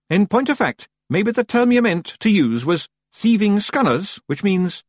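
high Scots Feagle voice; high-pitched Scottish character voice; explaining Feagle leader. A narrator's reading. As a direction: explain as a piping wee Scotsman.

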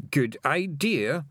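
drawn out, slow and thoughtful; slowly. A narrator's reading. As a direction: stretch each word as the grin spreads. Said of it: "he said slowly".

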